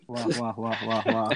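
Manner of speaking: low, drawn-out wah wah jeer; muffled wah wah talk. Acted. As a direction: groan it out low and mocking. Jeering at a bad answer.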